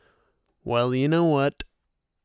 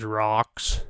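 ordinary talk

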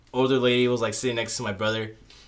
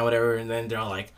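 normal talking voice

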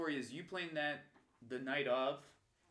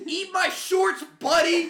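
high, loud taunting shout; triumphant taunt; 17 dB louder, slower than usual. Retold or imitated. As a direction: fling the line like a winning blow. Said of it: "hit him with the perfect line".

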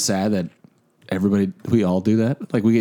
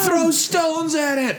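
shout; slower than usual. Pretend. Movie trailer mock shout.

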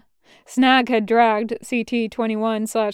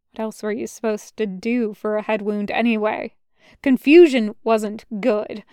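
usual speaking voice

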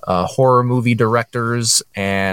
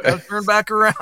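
high-pitched mock driver voice; hammed-up high impression of a lost friend; Jake; 10 semitones higher, faster than usual. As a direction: chatter high and silly as the driver. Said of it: "his driving and".

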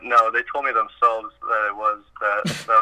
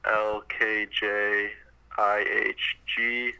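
slow alphabet recitation; 6 dB quieter, slower than usual. Real, not acted. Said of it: "Can you do the alphabet backwards for us".

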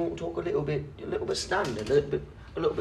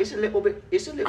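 squeaky, high mock British accent; 7 semitones higher, 5 dB louder, faster than usual. Joining his friend's bit: high mock British accent. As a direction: jump way up into a silly English voice. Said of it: "different English accents".

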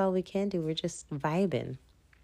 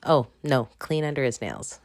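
ordinary talk, nothing marked

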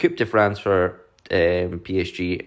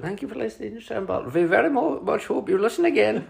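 put-on higher, fast, hammy German accent; 12 semitones higher than usual. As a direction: rush a terrible German sign-off. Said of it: "That was terrible".

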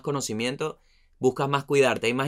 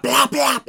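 much higher, louder mock shots, plap plap; imitated gunfire in a shootout; gunshot noise; 14 semitones higher, 9 dB louder than usual. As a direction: fire off plap plap like pistol shots.

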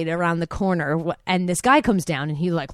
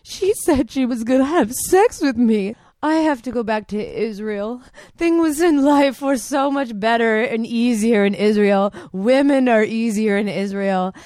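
a lightly put-on Israeli accent, whiny and a bit louder; mock Israeli accent; 3 semitones higher, 4 dB louder than usual. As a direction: whine like a sulking Israeli guy.

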